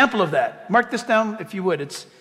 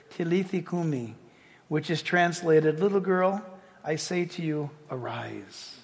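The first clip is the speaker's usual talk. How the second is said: tender command; 4 semitones lower, 7 dB quieter than usual. Retold or imitated. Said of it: "said to her".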